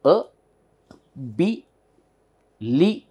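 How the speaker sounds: A, B, LI each alone as uh, bee, lee; long pause after each piece of "a, B, li"; syllable by syllable. Shown as a demonstration. Teacher opens mouth for each part, syllable by syllable. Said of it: "again we say B".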